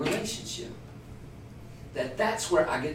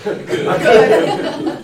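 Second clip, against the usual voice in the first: laughing; 5 semitones higher, 12 dB louder than usual. Real, not acted. Group laughing at the joke.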